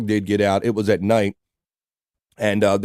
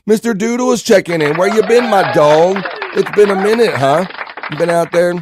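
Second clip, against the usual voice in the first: higher, louder delighted hello; excited greeting; 8 semitones higher, 4 dB louder than usual. Real, not acted. Host welcoming back a long-absent chatter, excited.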